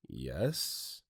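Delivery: low, soft, drawn-out yes; hesitant answer. Acted. Worker answering a frantic caller, hesitant. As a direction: answer cautiously, dropping well down in pitch.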